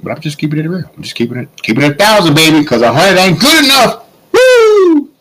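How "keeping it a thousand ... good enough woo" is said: high, hyped whoop at the end; excited. Real, not acted. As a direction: pump it up and holler. Hyped emphatic close.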